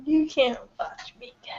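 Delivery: soft whispered aside; whispering. Real, not acted. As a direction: whisper it back.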